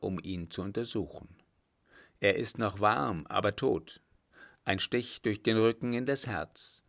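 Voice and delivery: ordinary delivery, nothing marked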